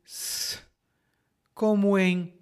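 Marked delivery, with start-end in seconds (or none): 0.0-0.6 "S" as a hissed sss, not the letter name; "S" alone, no vowel; s sound. Shown as a demonstration. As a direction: sound out "S" as pure hiss. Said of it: "O segundo som é de S".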